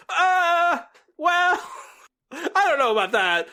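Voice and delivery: high, loud, unsure fan voice. Retold or imitated. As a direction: hem and haw loudly like a doubtful fan. Fans hedging about season two.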